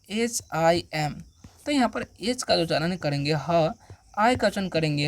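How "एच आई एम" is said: letters spelled slowly one by one; slow letter-by-letter spelling. Shown as a demonstration. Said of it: "अब देखिए next word क्या है".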